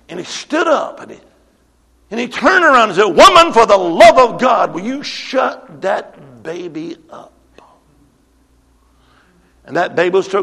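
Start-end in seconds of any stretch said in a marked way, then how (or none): 3.0-7.3 loud retold outburst, slowing hard at the end; exasperated outburst. Fed-up man rounding on the woman with the baby, exasperated. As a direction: burst out loudly, then bite off each word slowly.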